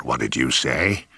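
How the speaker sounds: low, menacing snarl. Acted. Father turning on his daughter, low menacing snarl. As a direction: drop the voice into a low threat.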